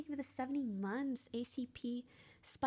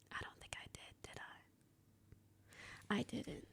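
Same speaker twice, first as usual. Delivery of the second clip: whisper. Real, not acted. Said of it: "whispered aside to self".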